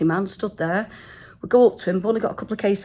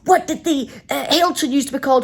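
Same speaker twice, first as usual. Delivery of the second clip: raised, insistent mock quizmaster; firing quiz questions; 6 semitones higher than usual. Taxi driver grilling two passengers, firing questions. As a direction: imitate a cabbie firing questions. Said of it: "firing questions at us".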